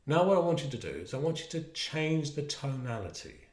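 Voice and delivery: usual speaking voice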